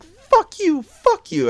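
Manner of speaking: put-on very high squeaky kid voice; squeaky young voice. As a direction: imitate your squeaky younger self cursing. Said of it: "My voice was squeaky".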